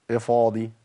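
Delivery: normal talking voice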